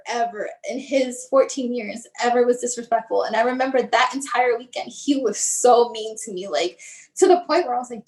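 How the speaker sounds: normal talking voice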